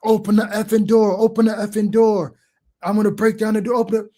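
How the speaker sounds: imitating an angry man pounding on a door; retold screaming. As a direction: act out the intruder's yelling, only half loud. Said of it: "he's screaming in the".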